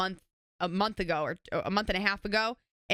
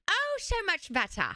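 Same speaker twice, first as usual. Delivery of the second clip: relieved; 10 semitones higher than usual. Real, not acted. Savoring the caffeine boost.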